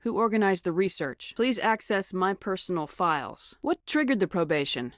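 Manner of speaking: ordinary talk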